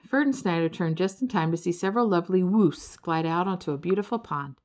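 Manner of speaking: usual speaking voice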